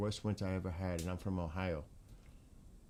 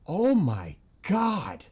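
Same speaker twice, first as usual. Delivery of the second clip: slow, high, loud oh my God; drawn-out gasp of real shock; stunned gasp; 8 semitones higher, 8 dB louder, much slower than usual. Real, not acted. Reacting to snow apocalypse footage.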